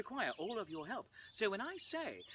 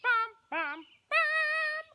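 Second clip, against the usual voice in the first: much higher, louder vocal stings; dramatic music sting; 9 dB louder, much slower than usual. Shown as a demonstration. Performer voicing dramatic music, rising loud. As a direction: belt out dramatic hits. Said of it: "We have dramatic music".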